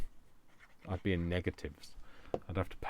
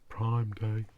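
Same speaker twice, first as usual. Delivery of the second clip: Prime Day stretched slowly and louder; slow, louder, oddly stressed Prime Day; quirky emphasis; 3 semitones higher, 6 dB louder than usual. Acted. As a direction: say Prime Day as if it were a grand event. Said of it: "Don't know why I said it like that".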